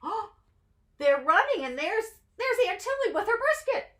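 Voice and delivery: high, fast excited chatter; thrilled by the chase picture; excited pointing. Real, not acted.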